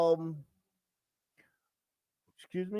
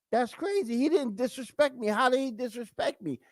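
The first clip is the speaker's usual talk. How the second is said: puzzled woman; 7 semitones higher than usual. Retold or imitated. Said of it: "where a woman might be like".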